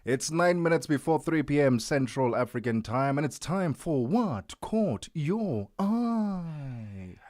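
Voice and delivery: high, loud, stretched-out radio intro; big showy announcer flourish; segment announcer. Real, not acted. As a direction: build to a long drawn-out title. Host announces the segment with fanfare.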